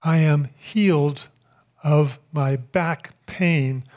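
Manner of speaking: slow, deliberate declaration; firm declaration. Retold or imitated. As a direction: declare it slowly, word by word. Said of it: "I declared".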